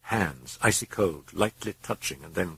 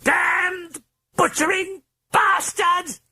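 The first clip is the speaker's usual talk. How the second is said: very high, slow, shrieking British voice; drawn-out high furious British shriek; hoarse British shriek; 4 dB louder, much slower than usual. Acted. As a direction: scream the curse at the intruder. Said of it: "it shrieked in the hoarse British accented voice".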